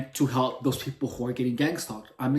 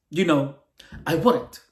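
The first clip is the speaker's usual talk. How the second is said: usual speaking voice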